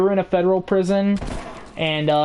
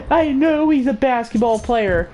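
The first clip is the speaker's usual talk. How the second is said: higher-pitched indignant retort; mock insistence; 6 semitones higher than usual. Cop argues about LeBron, mock insistence. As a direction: imitate someone insisting, pitched up.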